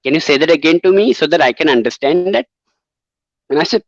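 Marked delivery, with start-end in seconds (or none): 0.0-2.5 put-on Indian English accent; mock Indian accent. Shown as a demonstration. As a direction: imitate an Indian caller asking for a repeat. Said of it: "Indian is will drive you crazy".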